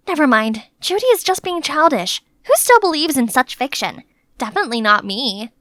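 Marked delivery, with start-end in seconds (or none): none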